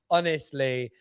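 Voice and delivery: normal talking voice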